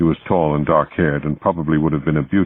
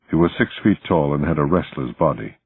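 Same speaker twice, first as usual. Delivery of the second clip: ordinary delivery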